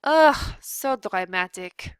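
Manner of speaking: loud ugh, then slow dismissive drawl; bored scorn. A narrator's reading. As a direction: roll her eyes at him. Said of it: "said Antoinette".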